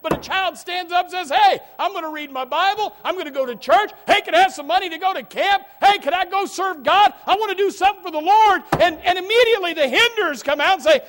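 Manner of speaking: loud, high fervent preaching; full-force pulpit shout. Real, not acted. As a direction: shout it out to the congregation.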